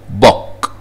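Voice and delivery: بوك with one short O sound; short double O. Shown as a demonstration. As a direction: cut the vowel short in بوك. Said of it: "كلها بتتنطق وان O". (بوك بصوت O واحدة قصيرة)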